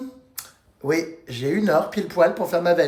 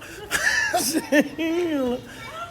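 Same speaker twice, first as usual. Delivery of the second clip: high laugh; 8 semitones higher, 4 dB quieter than usual. Real, not acted. Laughing with friend.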